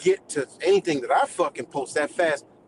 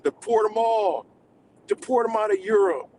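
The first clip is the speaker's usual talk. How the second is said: mock outcry; 7 semitones higher than usual. Streamer mimicking commenters demanding deportations, high mock outcry. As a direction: imitate commenters clamouring, pitched up.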